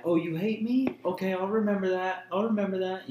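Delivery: high mock-offended mom; hammed-up hurt mother; mom's guilt trip. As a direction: imitate mom's wounded threat, up high. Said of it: "she was like".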